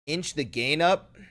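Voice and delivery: ordinary talk, nothing marked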